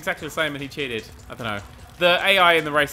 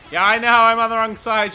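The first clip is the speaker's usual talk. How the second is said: excited; 7 semitones higher, 9 dB louder than usual. Real, not acted. Reacting to friend.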